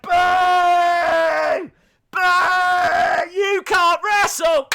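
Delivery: high, loud, slow jeering shout; mock booing heckler. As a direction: heckle from the stands, booing hard. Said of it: "he's like".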